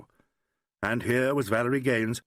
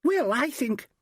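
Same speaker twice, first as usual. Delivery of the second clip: put-on high young woman; 14 semitones higher, slower than usual. A narrator's reading. Valerie voiced.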